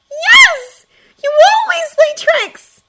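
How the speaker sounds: put-on high, loud, slow child's accusation; accusing little girl. A narrator's reading. Frightened sister on the ice accusing her prankster brother. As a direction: cry it out like a scared sister.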